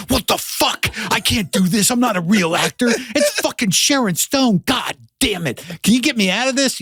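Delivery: high-pitched, panicked actor's tantrum; acted meltdown. Actor melting down to his manager in the trailer, high panicked rant. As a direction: whine it high, falling apart mid-shoot. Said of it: "It was sort of like that".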